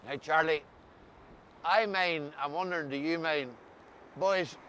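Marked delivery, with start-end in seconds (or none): none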